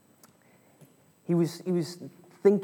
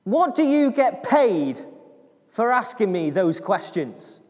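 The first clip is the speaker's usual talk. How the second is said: slow, raised question called to a sentry; deliberate, projected challenge, starting high and loud; calling back up; 5 semitones higher, 4 dB louder, slower than usual. Acted. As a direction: call it up slowly, easing off at the end. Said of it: "So he turns around and says".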